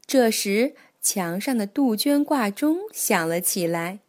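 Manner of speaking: plain reading voice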